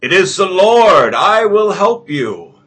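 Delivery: grand heavenly voice, loud; booming voice of God. Acted. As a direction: boom down from the sky as the Lord. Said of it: "this booming voice".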